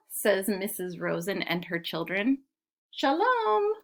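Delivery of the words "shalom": very high, drawn-out hello; calling a greeting. A narrator's reading. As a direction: sing out a storybook greeting. Voices story characters greeting someone.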